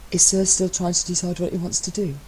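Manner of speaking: a hint of a sly, teasing sub; lightly voiced Matt. A narrator's reading. Matt needling his husband, lightly voiced sly tease.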